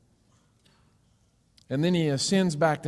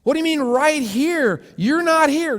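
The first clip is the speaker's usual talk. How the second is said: much higher, louder, incredulous protest; baffled square; 8 semitones higher, 5 dB louder than usual. Acted. High indignant disbelief. Square argues with unseen sphere.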